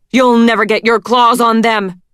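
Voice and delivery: loud furious roar. A narrator's reading. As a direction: roar it at the queen. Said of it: "he roared".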